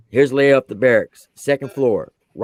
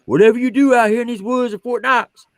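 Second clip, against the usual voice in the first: higher, booming Southern drill sergeant; imitating drill sergeant; 10 semitones higher than usual. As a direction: play the big Georgia sergeant warning the privates. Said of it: "he tells the privates".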